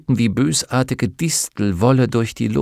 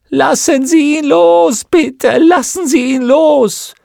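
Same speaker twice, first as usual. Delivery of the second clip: high, loud drawn-out cries; anguished, slow wail for mercy; desperate pleading; 9 dB louder, slower than usual. Acted. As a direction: beg at the top of your voice. Tortured witch pleads for release.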